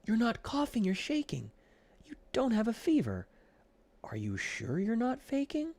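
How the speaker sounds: high, doubting little flea voice; suspicious Ed. A narrator's reading. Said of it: "replied Ed".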